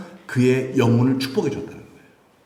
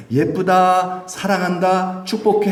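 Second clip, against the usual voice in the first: sweet blessing; 6 semitones higher, slower than usual. Acted. Blessing spoken over rice.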